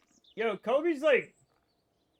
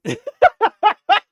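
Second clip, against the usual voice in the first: high, loud cackle of laughter; burst of laughter; 11 semitones higher, 10 dB louder than usual. Real, not acted. Streamer cracks up at chat, loud laughing. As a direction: let a sharp laugh burst out.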